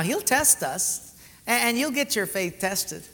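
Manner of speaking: ordinary talk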